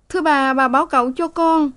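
put-on high, pleading young maid. A narrator's reading. Frightened maid begs her mistress to save her, high and pleading. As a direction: beg the mistress for help in a high voice.

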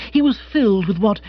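ordinary delivery

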